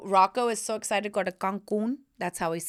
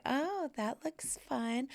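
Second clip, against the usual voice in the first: sultry hush; 5 semitones higher, 8 dB quieter, slower than usual. Retold or imitated. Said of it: "I'm like".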